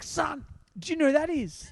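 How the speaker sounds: a mother stopping dead, high and amazed; mother's voice. Retold or imitated. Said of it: "she's like".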